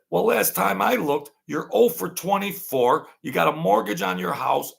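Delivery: high, playful ribbing of the gambler; retold teasing comeback. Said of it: "And I said".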